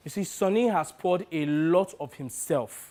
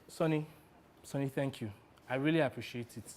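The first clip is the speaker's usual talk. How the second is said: soft, lowered, sincere gratitude; heartfelt thanks; 4 semitones lower, 9 dB quieter than usual. Acted. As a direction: thank him quietly and earnestly. Heartfelt thanks to his agent.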